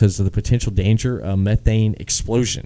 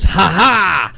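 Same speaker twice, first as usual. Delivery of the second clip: gleeful mock laugh; 9 semitones higher, 4 dB louder than usual. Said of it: "he goes".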